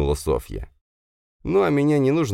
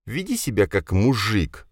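sharp rebuke; 5 semitones higher than usual. Acted. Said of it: "одёрнул я его".

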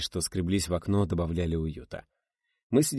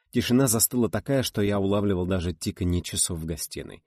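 ordinary delivery

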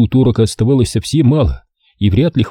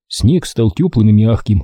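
ordinary delivery

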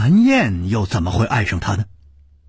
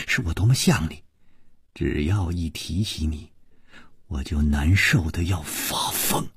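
low, much softer, venomous confession; jealous murderous woman; 6 semitones lower, 4 dB quieter than usual. Acted. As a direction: murmur it low, full of jealous hate. Gong Nanyan's low jealous confession.

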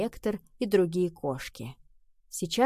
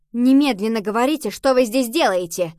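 loud, insistent interrogation; forceful, louder order to intruders; stern demand; 3 semitones higher, 8 dB louder than usual. A narrator's reading. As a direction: demand an answer at once.

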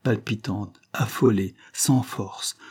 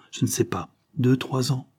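usual speaking voice

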